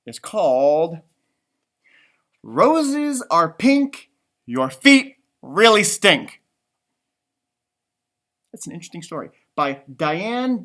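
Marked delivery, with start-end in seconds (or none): 2.4-6.4 slow, louder title read; title announcement. Acted. Host presenting the book title, slow and loud. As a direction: announce the title grandly and slowly.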